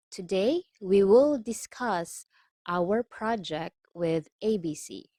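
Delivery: careful phrases with pauses between; slow reading. Shown as a demonstration. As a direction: read it out slowly, chunk by chunk. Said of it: "let's take this slowly".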